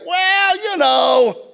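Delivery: high, loud drawn-out stalling; reluctant hedging. Retold or imitated. Buyer about to admit the breakdown, high reluctant hedging. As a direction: hem and haw up high, acting out reluctance.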